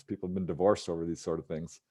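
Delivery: ordinary delivery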